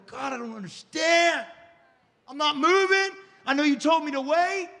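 high, loud pleading shout; desperate cry to God. Acted. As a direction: cry out to God, begging for help. Crying out to God in prayer.

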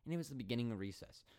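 normal talking voice

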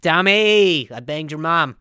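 brash shouted boast; loud taunt. Acted. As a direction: yell it out like a bragging rival. Cougar King taunting Tommy.

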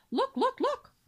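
high, loud repeated cry; excited. Acted. Cygnet rushes to his parents, high and excited. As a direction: urge them to look up.

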